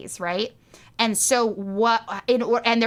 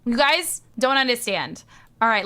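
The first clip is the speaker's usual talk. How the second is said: ordinary talk, nothing marked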